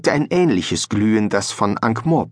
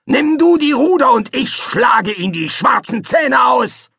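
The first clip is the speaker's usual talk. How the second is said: high, loud battle-hungry shout; furious shout; 4 dB louder than usual. Acted. Fester orders his son to row while he fights, a high loud shout. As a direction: roar the order to take the oars.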